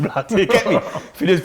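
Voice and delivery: ordinary talk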